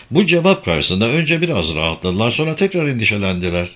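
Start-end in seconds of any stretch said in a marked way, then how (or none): none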